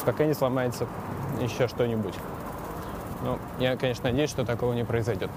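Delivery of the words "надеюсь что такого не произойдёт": ordinary talk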